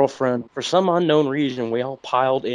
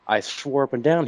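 normal talking voice

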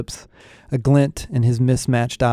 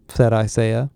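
ordinary delivery

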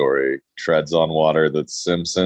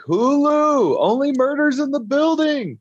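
squeaky exaggerated falsetto; very high silly voice. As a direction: sing out the title high. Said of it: "And you're like".

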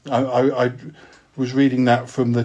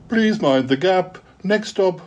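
much higher, sing-song station announcement; tube announcer; 6 semitones higher, slower than usual. Acted. Underground crew announce the next stop, mock Tube announcer. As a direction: call it out like a Tube platform announcer. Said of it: "the underground crew say".

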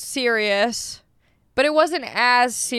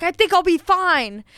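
higher-pitched retold retort; snippy, raised-pitch brush-off; angry comeback; 6 semitones higher than usual. Angry driver to neighbour about parking, retold comeback. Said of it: "it made me so angry".

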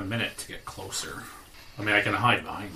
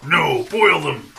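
giant voice; 6 semitones higher, 8 dB louder, slower than usual. Pretend. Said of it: "Start talking like a giant".

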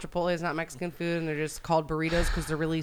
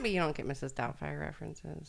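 ordinary delivery

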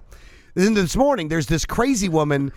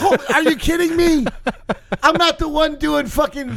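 higher, indignant protest; 10 semitones higher than usual. Real, not acted. Friend accused of being the crazy one, indignant. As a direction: act offended at the idea.

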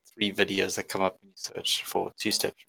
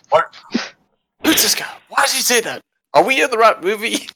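high, fast puzzled questions; confused boss; 12 semitones higher, 3 dB louder, faster than usual. Retold or imitated. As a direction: play the baffled CEO. CEO voiced asking confused questions.

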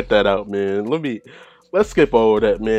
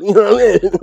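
high, loud words through laughter; laughing; 10 semitones higher, 9 dB louder than usual. Real, not acted. Host cracking up over a racy video, laughing. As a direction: crack up while talking.

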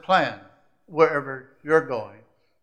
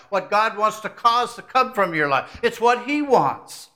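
preaching that climbs louder and higher; building preaching; 4 semitones higher, 5 dB louder than usual. Acted. Preacher rallying the congregation, rising to full force. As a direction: build the sermon to a climax.

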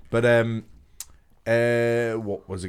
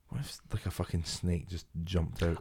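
soft unvoiced worry voice; little inner voice; 6 semitones lower, 14 dB quieter than usual. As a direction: breathe it out like a nagging thought. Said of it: "a little voice in me going".

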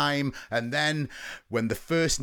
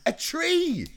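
excited reveal; 12 semitones higher than usual. Acted. Mock reveal.

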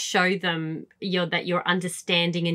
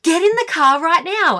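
frantic demand; 10 semitones higher, 4 dB louder than usual. Retold or imitated. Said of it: "I'm like".

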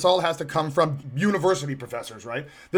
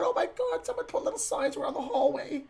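squealed, much higher mock panic through a laugh; mock freak-out; 8 semitones higher, 5 dB quieter than usual. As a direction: parody hysteria while laughing. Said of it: "Everyone's gotta freak out".

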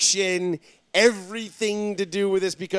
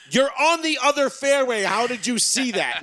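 incredulous yell; 8 semitones higher, 4 dB louder than usual. Retold or imitated. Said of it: "I'm like".

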